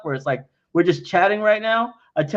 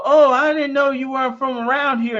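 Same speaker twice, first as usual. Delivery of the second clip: higher, chatty Southern local; friendly Southerner; 8 semitones higher than usual. Retold or imitated. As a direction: gush like a helpful Southern stranger. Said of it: "they go".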